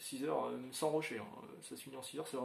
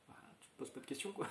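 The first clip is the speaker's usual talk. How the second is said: whispered aside; 10 dB quieter, faster than usual. Real, not acted. Aside about a cheap deal.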